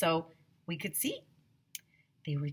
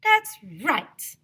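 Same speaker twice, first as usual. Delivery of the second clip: put-on high, eager girl agreeing; Emily backing up Andrea, high voice; Emily's voice; 9 semitones higher, 7 dB louder than usual. A narrator's reading. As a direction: chirp along with your friend. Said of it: "said Emily".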